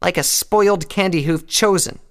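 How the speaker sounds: high, loud insult; Rainbow's hardened anger. A narrator's reading. Rainbow insulting Applejack after hesitating, hard and angry. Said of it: "hardening her expression and continuing".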